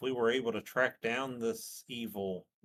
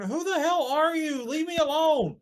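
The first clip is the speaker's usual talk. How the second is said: much higher, louder yell; angry confrontation; 11 dB louder than usual. Acted. Player's character yelling at the man following her, high and loud. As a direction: turn and yell him off.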